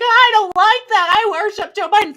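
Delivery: high, loud whiny mimic of a Biden supporter; mock the prissy Biden worshipper; mocking Biden fan. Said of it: "someone's like".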